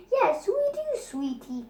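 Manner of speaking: high cooing dad voice. Acted. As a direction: coo it fondly to the baby. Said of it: "cooed Simon".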